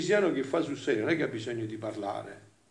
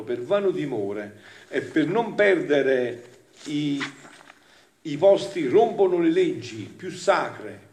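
raised, louder reading to the congregation; impassioned devotional reading; 3 semitones higher, 6 dB louder than usual. Acted. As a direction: declaim the sacred text with force. Reads devotional text aloud.

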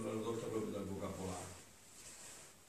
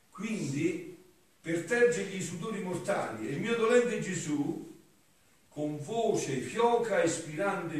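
raised, loud declaiming voice; higher-pitched oratorical reading aloud; solemn devotional reading; 6 semitones higher, 9 dB louder than usual. Acted. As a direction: declaim the sacred text with fervour. Reads devotional text aloud.

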